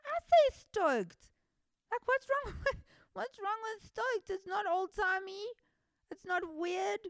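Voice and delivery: high, squeaky, baffled protest; a cartoon-high outraged voice; mock-offended high whine; indignant mock squeal. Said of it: "and I'm like".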